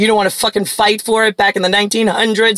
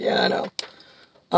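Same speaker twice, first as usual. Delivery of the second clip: choking back tears; 9 dB quieter, much slower than usual. Retold or imitated. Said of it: "as I choked back tears".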